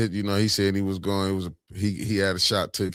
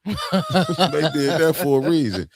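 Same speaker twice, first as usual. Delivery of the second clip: laughing; 6 semitones higher, 4 dB louder, slower than usual. Real, not acted. Laughing about the attack.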